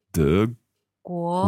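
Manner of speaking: 德国 with both tones rising, 国 lifted high; tone demo. Shown as a demonstration. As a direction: sound out 德国 with a clear rising 国. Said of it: "because Germany was".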